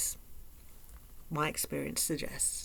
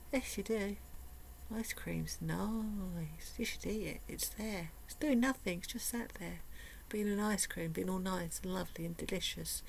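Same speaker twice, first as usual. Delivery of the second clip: soft, sly coaxing murmur, softer throughout; coaxing inner voice; 7 dB quieter than usual. Retold or imitated. Inner voice coaxing her to eat ice cream, softly wheedling. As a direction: wheedle gently, like a temptation. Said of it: "your little brain ... will be saying".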